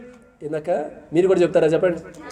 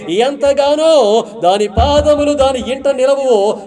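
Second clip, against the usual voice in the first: slow scripture emphasis; 4 semitones higher, slower than usual. Acted.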